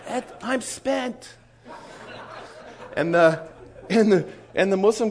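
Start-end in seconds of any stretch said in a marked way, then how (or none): 0.1-1.4 high, slow, soft dude voice; Mana's spent voice. Retold or imitated. Mana out of material for the guy, comically spent. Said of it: "And Mana goes".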